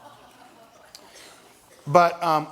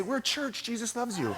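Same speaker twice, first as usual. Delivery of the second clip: sheepish follow-up; 9 semitones higher, 8 dB quieter than usual. Retold or imitated. Calling after runner, sheepish follow-up.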